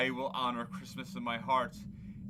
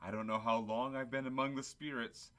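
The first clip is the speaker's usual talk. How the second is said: ordinary talk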